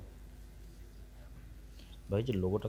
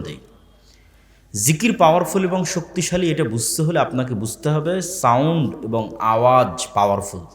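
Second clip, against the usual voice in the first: raised, declaiming preacher's delivery; emphatic Bengali sermon voice; sermon preaching; 5 semitones higher, 18 dB louder than usual. Acted. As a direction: preach it to the gathering. Preacher addresses audience on zikr.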